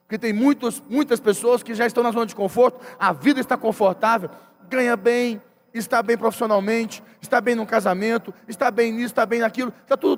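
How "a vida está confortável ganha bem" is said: normal talking voice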